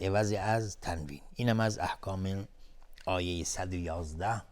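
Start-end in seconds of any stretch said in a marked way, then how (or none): none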